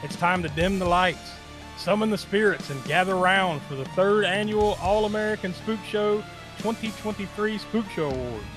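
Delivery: showman welcoming the audience; awards show host. Acted. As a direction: announce it like a gala host.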